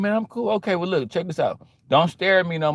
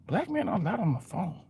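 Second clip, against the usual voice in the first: hushed, scandalized aside; nosy onlooker; 2 semitones higher, 9 dB quieter than usual. Retold or imitated. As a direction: mutter it like it's outrageous. Onlooker whispering.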